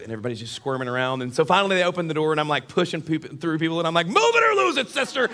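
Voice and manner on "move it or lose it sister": high, loud mock bark; hammed-up impatient passenger; pushy shout. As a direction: holler your way through a crowd. Pushing through passengers in the story.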